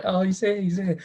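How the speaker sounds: high-pitched, gushing imitation of relief; mock delighted welcome. News reporter as the kid returns, mock delighted. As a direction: welcome him back in a high, excited voice.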